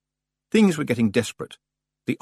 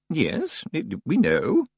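higher, halting reply; puzzled librarian; 7 semitones higher, much slower than usual. A narrator's reading. Librarian replying to the stranger, higher and puzzled. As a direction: answer with puzzled hesitation. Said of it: "looking puzzled".

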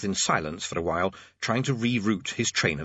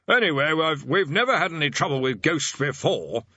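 senior tutor voice; 5 semitones higher than usual. A narrator's reading.

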